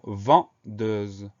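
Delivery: "vendeuse" slowly split into its syllables; découpé en syllabes. Shown as a demonstration. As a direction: dictate "vendeuse" in slow, separate syllables. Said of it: "Trois syllabes". (« vendeuse » prononcé lentement, en détachant les syllabes)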